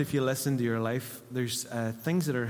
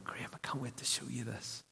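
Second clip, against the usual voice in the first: soft, intimate voice of the Father; hushed Father voice; 11 dB quieter than usual. Retold or imitated. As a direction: lean in and say it tenderly.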